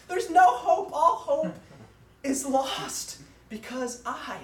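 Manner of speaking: high, loud, hammed-up wail; mock crying. Preacher replays his childhood panic over the jar, mock crying. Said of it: "and I'm crying. I was like".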